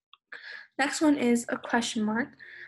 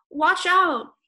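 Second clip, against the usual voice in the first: urgent warning shout, high and loud; emotional yell; 7 semitones higher, 7 dB louder than usual. Shown as a demonstration. Teacher demonstrating an exclamation point to students, emotional yell. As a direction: yell it out to alert someone in danger. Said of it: "yell it full of emotion".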